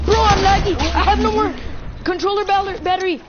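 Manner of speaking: high, loud panicked complaint; frantic gamer panic; hyped gamer bro. Real, not acted. Panicking over lag mid-fight.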